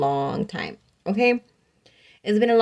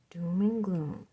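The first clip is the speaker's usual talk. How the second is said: doom and gloom; 2 semitones lower, 7 dB quieter, slower than usual. Acted. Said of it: "all of a sudden we're just like".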